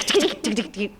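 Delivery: rapid clicky ticks imitating keys; quick mock keyboard clatter; imitated typing. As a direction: tap out typing noises with the tongue. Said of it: "I was banging away".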